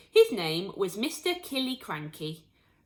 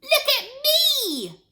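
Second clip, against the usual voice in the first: much higher, slow, loud call; shouting grandma; 8 dB louder, slower than usual. A narrator's reading. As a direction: call down from the roof, delighted. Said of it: "Grandma shouted from the rooftop".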